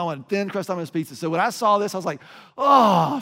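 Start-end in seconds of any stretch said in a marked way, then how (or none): 2.6-3.2 loud, higher exclamation of relief; acted relief. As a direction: let out a big relieved "oh". Acts out relief on seeing Domino's.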